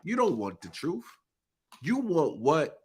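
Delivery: ordinary talk, nothing marked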